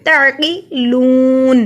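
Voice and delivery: "تعقلون" slowly as ta'-qiluun, throat ain and long uu; clear ain. Shown as a demonstration. Teacher correcting learners, "تعقلون" with ain, drawn out. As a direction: voice the ain, then draw out the end of "تعقلون". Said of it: "عین پڑھیں گے".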